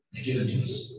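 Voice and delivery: ordinary talk, nothing marked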